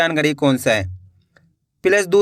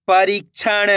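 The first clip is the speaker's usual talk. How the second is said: slow, stretched reading of the whole word; joined-up word; slower than usual. Shown as a demonstration. Teacher showing learners the finished word, slowly joined up. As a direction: blend the syllables into one drawn-out word. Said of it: "तो मिलके बन जाएगा".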